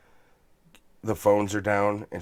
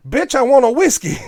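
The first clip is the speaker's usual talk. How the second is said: much higher, louder mock demand; joking shout; 14 semitones higher, 10 dB louder than usual. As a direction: bark it at the stewardess as a joke. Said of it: "I immediately come out with".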